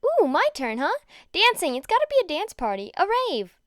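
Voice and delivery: high, bouncy and loud; excited high voice; eager excitement. Acted. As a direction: pitch it up with party glee. Mina eagerly suggests party.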